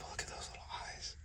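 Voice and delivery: quick, breathless whisper of adoration; whispered coo. Real, not acted. Breeder cooing at a magpie python, whispered. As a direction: whisper it like you're charmed. Cooing over snake's eyes.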